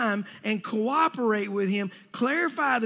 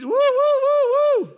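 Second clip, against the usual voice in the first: very high, loud, long drawn-out woo; shepherd's woo call; 9 dB louder, slower than usual. Retold or imitated. Shepherd calling his sheep with long, high, loud woos. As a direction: stretch each woo out like a yodel to the flock.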